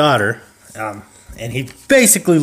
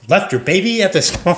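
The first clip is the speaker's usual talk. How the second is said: mock disbelief; 7 semitones higher than usual. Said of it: "She's like".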